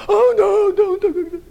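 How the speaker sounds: mocking the squeamish objection loudly; shrill mock protest. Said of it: "Satan wants you to go".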